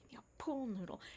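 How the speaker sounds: whispering in disbelief; amused whisper. Real, not acted. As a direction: breathe it out as a private joke. Amused repeat of pool noodle.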